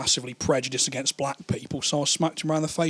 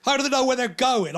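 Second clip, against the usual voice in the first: usual speaking voice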